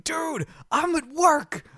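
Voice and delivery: high-pitched, drawn-out imagined complaint; hammed-up high-pitched protest; mock protest. Imagined listener protesting at work.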